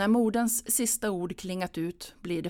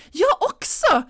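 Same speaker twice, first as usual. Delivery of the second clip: much higher and louder cry; calling out; 12 dB louder than usual. Acted. Anna chiming in with her mother: high loud call. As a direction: shout it out eagerly. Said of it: "ropar Anna".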